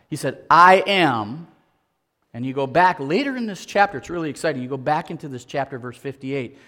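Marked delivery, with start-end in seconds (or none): 0.5-1.5 booming declaration. Acted. Quoting Jesus' divine claim.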